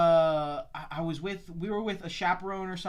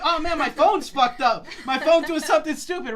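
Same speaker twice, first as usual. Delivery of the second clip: higher, louder exasperated complaint; mad; 8 semitones higher, 6 dB louder than usual. Retold or imitated. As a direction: fume at a faulty phone. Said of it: "I was mad".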